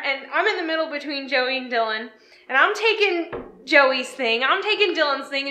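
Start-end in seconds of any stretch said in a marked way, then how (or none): none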